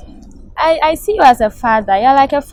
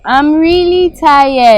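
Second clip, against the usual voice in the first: exasperated; 2 semitones higher, 7 dB louder, much slower than usual. Acted. Girlfriend exhausted in quarrel.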